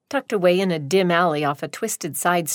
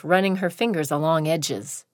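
ordinary delivery, nothing marked

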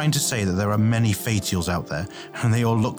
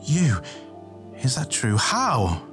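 high, incredulous questions; stunned and higher-pitched; shocked; 5 semitones higher than usual. A narrator's reading. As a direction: ask in shocked disbelief, pitch raised. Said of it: "Tay gasped in shock".

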